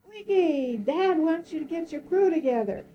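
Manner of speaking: high mock call across the water; retold megaphone yell. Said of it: "and he yelled".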